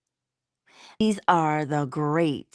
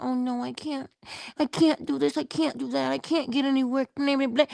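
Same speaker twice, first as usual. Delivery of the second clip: higher whiny self-pity; mock moping; 9 semitones higher, faster than usual. Mocking someone moping about, a higher whiny voice. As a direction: whine it like a moper. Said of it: "moping about the place, talking about".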